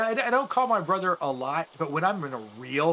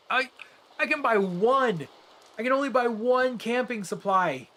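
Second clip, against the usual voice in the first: exasperated complaint; 6 semitones higher than usual. Real, not acted. Game shop won't sell more.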